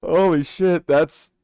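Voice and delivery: loud, high, stretched-out disbelief; shocked exclamation. Real, not acted. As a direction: blurt it out, stunned by the noise. Shocked by a blaring donation sound.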